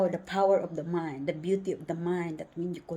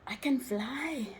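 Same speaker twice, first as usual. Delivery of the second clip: slow awed whisper; 5 semitones higher, slower than usual. Real, not acted. Joyful traveler telling viewers she can fly, whispering. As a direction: breathe it out slowly in wonder. Overwhelmed with joy.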